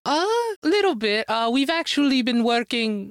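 a quick imitation of a Swedish pop star's accent. Retelling how [name] answered him, in a mock Swedish accent. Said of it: "Is that your Swedish accent?".

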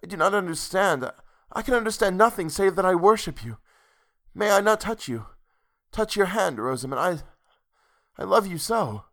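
high, impassioned pleading; worshipful lover begging to understand; anguished lover. A narrator's reading. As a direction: plead in bewildered sorrow, pitched well up. Man pleads, bewildered by her words.

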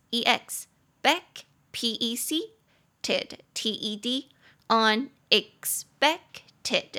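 unexpected broken into syllables and spelled very slowly; syllable-by-syllable spelling. Shown as a demonstration. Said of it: "中音节在第三音节". (逐个音节慢慢拼unexpected)

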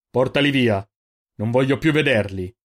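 loud, forceful command; angry order. Acted. Giofix has the prisoners taken away, angry order. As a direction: order them out of your sight.

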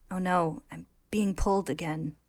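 ordinary talk

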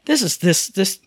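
stumble, then slow down on the verdict; exasperated disbelief. Retold or imitated. Gamer reacting to odd pronunciations, mock exasperation. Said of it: "and I went".

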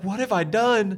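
high, lightly mocked tearful wail; tearful wife imitation. Husband imitating his dramatic wife tearing up, mock tearful. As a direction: imitate a dramatic, guilt-stricken mother. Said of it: "she starts tearing up".

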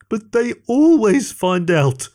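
high, slow whining plea; whiny. A narrator's reading. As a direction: whine it like a worried kid. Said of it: "whined Greg".

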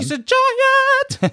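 high, drawn-out squeal of astonishment; hugely high, slow exclamation; excited exclamation. Real, not acted. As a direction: stretch it out in a very high, delighted cry. Exclaims at the drag queen's towering height.

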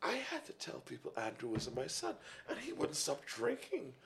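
hushed tattling mother; quiet mother complaint. Retold or imitated. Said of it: "Mommy goes".

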